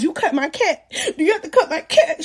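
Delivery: rising into a high, squeaky plea; mock alarmed question. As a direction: ask it in climbing, hammed-up dread. Retelling alarm at coming surgery.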